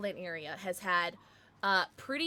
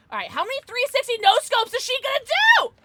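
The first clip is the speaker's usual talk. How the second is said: loud, high, incredulous call-out; hyped disbelief; 13 dB louder than usual. Real, not acted. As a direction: crow with excitement at a teammate's stunts. Watching a teammate show off.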